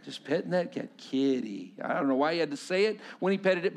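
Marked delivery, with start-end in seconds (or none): 1.0-1.7 put-on toddler voice. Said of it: "you watch this little boy".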